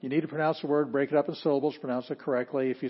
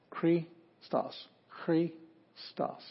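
"Christos" split as CHRI, STOS with a clear pause; divided into syllables; 4 semitones higher, 3 dB quieter, much slower than usual. Shown as a demonstration. Teacher showing students the syllable division, "Christos" said in two separated parts. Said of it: "that's how that's divided".

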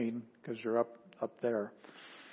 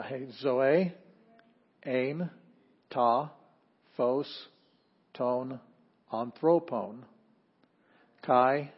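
slow word-by-word Greek recitation, each word set apart; reading Greek aloud; much slower than usual. Shown as a demonstration. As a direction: read the Greek text aloud with the class, one word at a time. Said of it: "Can we read it together instead of pronouncing after me".

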